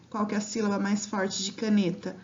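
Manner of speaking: ordinary talk, nothing marked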